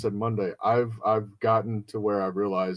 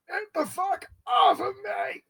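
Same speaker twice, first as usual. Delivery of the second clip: much higher, crying shout that fades to a gasp; mock crying shout. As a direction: play the crushed guy yelling through tears. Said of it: "and he's like".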